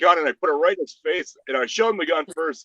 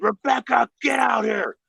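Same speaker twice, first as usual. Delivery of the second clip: raised, higher mock yell; imitated yelling; 9 semitones higher, 4 dB louder than usual. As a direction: call out like an enraged man demanding his girlfriend. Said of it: "he's yelling for his wife or girlfriend".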